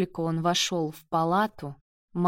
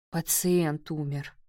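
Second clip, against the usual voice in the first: understated, lifeless, slightly lowered delivery; 3 semitones lower than usual. A narrator's reading. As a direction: say it with the life drained out. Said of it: "ответил безжизненным голосом". (безжизненным голосом)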